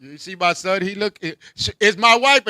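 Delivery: ordinary delivery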